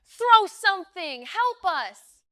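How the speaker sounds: very loud, high plea for help; frantic shouting; desperate yell. A narrator's reading. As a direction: scream to the boat for rescue. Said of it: "I yelled".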